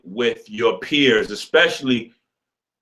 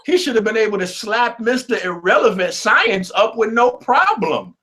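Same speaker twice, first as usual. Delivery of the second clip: excited high rant; 7 semitones higher than usual. Real, not acted. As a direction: rant it up high in mock outrage.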